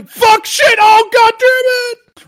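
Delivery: high loud screaming deer; panicked deer scream. Retold or imitated. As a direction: shriek as the car hits. Deer voice as car hits.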